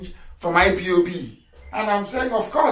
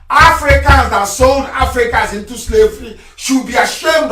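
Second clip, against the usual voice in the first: loud, high sermon cry; full-force preacher over music; fiery preaching; 7 semitones higher, 7 dB louder than usual. Real, not acted. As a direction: preach it hard to the crowd.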